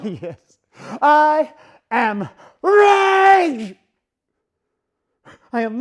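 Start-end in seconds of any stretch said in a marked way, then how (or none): 1.0-3.8 slow, loud, crying roar; raging shout. Acted. Dummy confronting the watchers, raging shout. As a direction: tear the words out.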